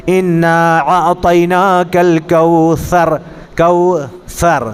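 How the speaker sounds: slowly recited "الکوثر" with th as in "think"; thā demonstration. Shown as a demonstration. Teacher gives a Quran example, slow recitation with clear th in "الکوثر". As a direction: draw out "کوثر", tongue tip out on the thā. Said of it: "یا ثے کا تلفظ کیا ہے".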